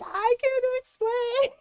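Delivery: put-on high, slow, whiny kid caught lying; panicked plea. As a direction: plead helplessly, pitch way up. Caught kid pleads with dad.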